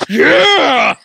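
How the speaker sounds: sudden huge high yell right in his face; roaring game-day yell; full-on scream. Retold or imitated. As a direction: blast it like a fan at a bar. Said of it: "I just screamed in his face".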